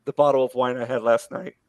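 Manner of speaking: ordinary talk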